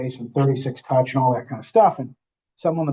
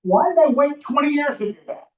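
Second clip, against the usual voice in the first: much higher, almost disbelieving; incredulous self-question; 11 semitones higher than usual. Retold or imitated. Imagined agent kicking themselves over twenty lost years, amazed. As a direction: ask yourself in amazement why you waited. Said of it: "you're like".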